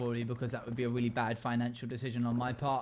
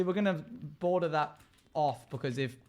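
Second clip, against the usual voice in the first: ordinary talk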